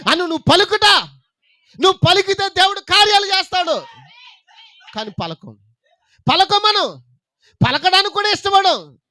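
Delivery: high, loud sermon shout; fiery preaching. Acted. Preacher shouting at the congregation at full force. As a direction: drive the point home.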